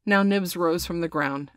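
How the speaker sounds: plain reading voice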